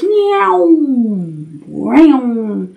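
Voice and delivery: zoom like something flung through the air; flying zoom noise. A narrator's reading. Imitating the catapulted spider flying.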